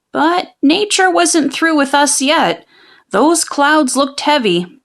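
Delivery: plain reading voice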